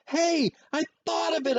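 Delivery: put-on high, loud kid with a big idea; thrilled child hollering; excited holler. A narrator's reading. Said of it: "I hollered".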